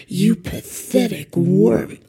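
drawn-out sinister demon snarl; slow demon voice. Acted. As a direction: stretch it out like a fiend. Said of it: "drop the voice".

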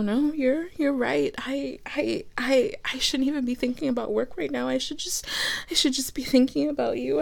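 soft and quiet, gently comforting; calm the partner down; tender reassurance. Acted. Tender reply to apology.

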